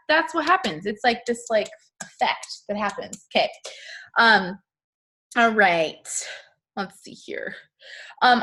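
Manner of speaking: usual speaking voice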